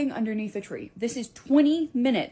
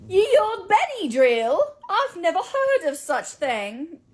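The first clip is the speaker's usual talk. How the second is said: put-on high, slow quaint old-fashioned voice; olde-timey shopkeeper; 10 semitones higher, 7 dB louder, slower than usual. Costumed shopkeeper answering the teacher in olde-timey speech. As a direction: play a clueless pioneer shopkeeper.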